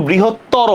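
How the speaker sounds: বৃহত্তর as 'brihottoro', open at the end; final vowel sounded. Shown as a demonstration. Teacher singles out the answer, sounding the final o of বৃহত্তর. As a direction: say বৃহত্তর with an audible o after the r. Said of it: "অ উচ্চারণ আছে না?".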